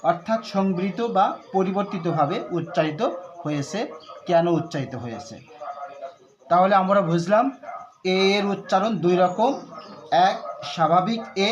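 normal talking voice

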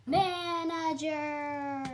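very slow stretched call; long call. Real, not acted. Gamer calling the manager, very drawn out. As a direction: stretch the word out across the room.

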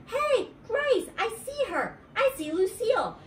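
high-pitched excited call to a friend. A narrator's reading. Child calls out to friend.

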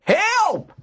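very high, drawn-out rabbit cry; shouted cry for help. A narrator's reading. Rabbit covered in tar, high shouted distress. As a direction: call out for help as a trapped rabbit. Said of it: "he shouted".

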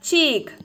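"ചീക്ക്" in one piece, high and loud; whole word. Shown as a demonstration. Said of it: "അപ്പോൾ എങ്ങനെയാണ്".

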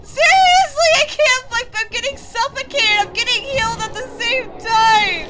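panicked yelling. Real, not acted. Still panicked, yelling excuses.

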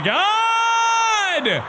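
high, stretched Oh my God yelled again and again; drawn-out frustrated cry. Real, not acted. Gamer losing it at the game, long high wails. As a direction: wail it long and high at the screen.

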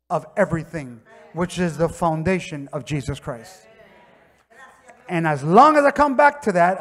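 normal talking voice

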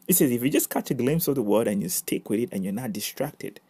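ordinary talk, nothing marked

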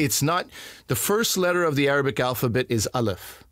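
usual speaking voice

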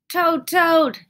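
high, loud call through the door; shouting. A narrator's reading. Frog shouting for Toad outside his house. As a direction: call out for a friend who won't answer. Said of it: "shouted Frog".

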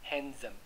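slow "handsome" as HAN-zum. Shown as a demonstration. Teacher summing up the rule, "handsome" said with a z. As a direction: merge the ds of "handsome" into z. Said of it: "D跟S你要念Z".

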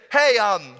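high, gushing awe; comic overeager amazement; awkward Peter. Acted. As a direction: blurt it out like a starstruck disciple. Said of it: "this is what he says".

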